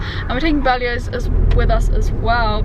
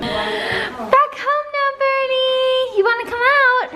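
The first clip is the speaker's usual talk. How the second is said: sing-song pet talk; 12 semitones higher, slower than usual. Real, not acted. Greeting bird at home.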